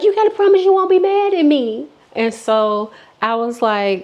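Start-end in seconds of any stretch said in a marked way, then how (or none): 0.0-1.9 much higher, fast whine; pleading friend. Retold or imitated. Drunk friend begging for a promise, high whine. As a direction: wheedle like a guilty friend. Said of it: "she like".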